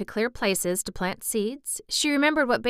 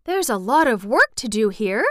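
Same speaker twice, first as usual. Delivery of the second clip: exultant; 3 semitones higher, 7 dB louder than usual. A narrator's reading. Boy surveying the work to do, exultant. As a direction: relish the job ahead. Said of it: "looking about quite exultantly".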